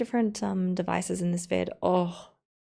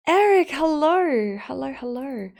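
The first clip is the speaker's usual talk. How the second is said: excited greeting; 6 semitones higher, 8 dB louder than usual. Real, not acted. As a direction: call out the hello brightly. Greeting the viewer by name.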